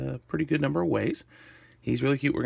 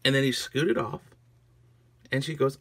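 ordinary delivery, nothing marked

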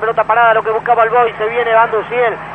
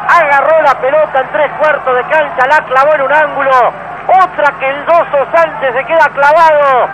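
breakneck goal commentary; 2 semitones higher than usual. Real, not acted. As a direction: yell the goal with stretched vowels. Goal call after a strike.

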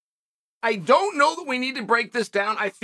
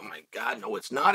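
much lower and softer mock gasp of alarm; mock alarmed commission; 12 semitones lower, 8 dB quieter than usual. Host mocks the commission overreacting, hushed low mock alarm. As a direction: play the commission pretending to panic, low and hushed.

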